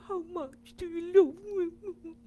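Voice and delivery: high, loud squeaky little-creature voice; high squealing baby voice; little one's voice. Acted. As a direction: pipe it up like a tiny excited bunny. Little bear asks, high squeaky voice.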